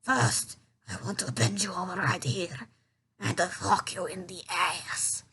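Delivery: low, lightly voiced villain; movie villain. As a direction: imitate a hammy villain stating his plan. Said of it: "in true villain style".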